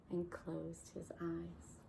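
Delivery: soft hushed narration. A narrator's reading. Bedtime story ending softly.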